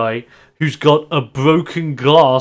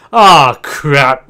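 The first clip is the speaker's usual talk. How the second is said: annoyed groan; 8 dB louder than usual. Acted. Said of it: "He's like".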